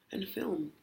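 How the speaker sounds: soft whispered aside. Real, not acted. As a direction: breathe the words out. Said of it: "whispered punchline".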